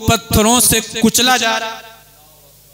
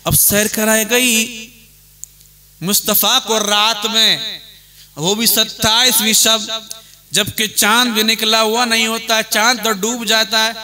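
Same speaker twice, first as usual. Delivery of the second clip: ordinary talk, nothing marked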